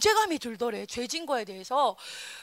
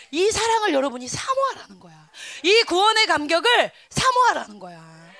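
preaching shout; 4 dB louder than usual. Real, not acted. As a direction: drive the point home with a shout. Preaching climax.

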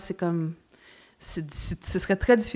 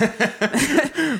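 laughing; 7 semitones higher, 4 dB louder than usual. Real, not acted. Laughs at own joke.

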